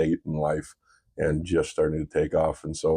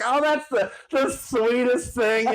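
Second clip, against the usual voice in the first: much higher, louder, slow Southern gush; sweet Southern lady; 3 dB louder, slower than usual. Retold or imitated. Dad imitating the delighted Southern worker in a Southern accent. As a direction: gush like a charmed Southern waitress. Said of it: "She's like".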